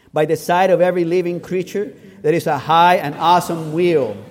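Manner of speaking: loud, measured recitation; emphatic public reading; leading a group reading. Real, not acted. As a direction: read it out firmly for all to follow. Leads outline reading aloud.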